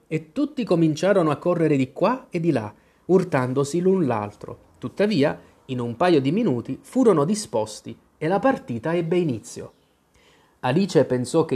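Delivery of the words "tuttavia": ordinary delivery, nothing marked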